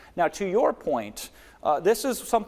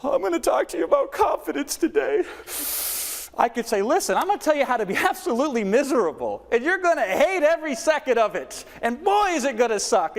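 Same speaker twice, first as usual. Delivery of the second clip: much higher and louder, bursting with energy; excited delivery; 10 semitones higher, 3 dB louder than usual. Shown as a demonstration. Coach shows how excitement sells even bad news. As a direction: sell it with huge enthusiasm. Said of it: "if I'm excited".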